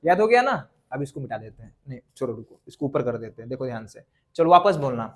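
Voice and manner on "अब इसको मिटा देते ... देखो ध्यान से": muttering aside. Real, not acted. Teacher muttering while at board.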